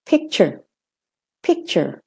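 "picture" with a short American er at the end; de-stressed ending. Shown as a demonstration. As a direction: keep the last syllable of "picture" short. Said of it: "So it's".

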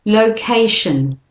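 "Location" slow, stress on ca, weak shun; slow model word. Shown as a demonstration. Teacher reading list words for learners, slow. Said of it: "doing them in the order".

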